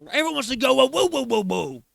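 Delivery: high, loud whoas tailing down; mock outrage. Reactor mimicking what everyone wants to go, loud mockery. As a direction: parody a fuss with loud whoas.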